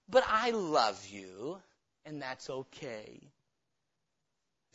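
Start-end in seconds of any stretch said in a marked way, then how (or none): 0.1-3.2 softer, slow, mock-sweet reassurance; mock indulgent tenderness. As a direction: parody a soft, permissive comfort. Mocking an indulgent God.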